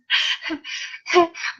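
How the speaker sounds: breathy laugh burst; helpless giggle. Real, not acted. Laughing at the memory.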